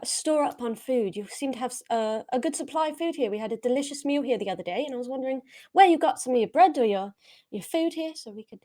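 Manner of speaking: ordinary talk, nothing marked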